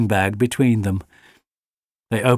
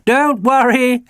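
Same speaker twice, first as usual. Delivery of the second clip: high, louder call over the howling; shouting; 13 semitones higher, 5 dB louder than usual. A narrator's reading. Jenny calls over the howling dog, mild shout. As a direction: raise the voice over the noise. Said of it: "Jenny shouted".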